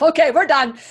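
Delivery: repeats it through a laugh; amused laughing echo. Real, not acted. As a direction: laugh the words out. Laughing after the phrase.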